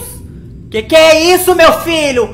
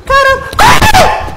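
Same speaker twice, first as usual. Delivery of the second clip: very high yelled order to run; panicked shriek; 14 semitones higher, much slower than usual. Real, not acted. Pânico no jogo. (grito de pânico)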